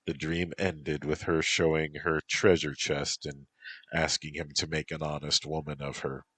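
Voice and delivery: ordinary delivery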